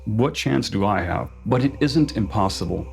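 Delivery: ordinary talk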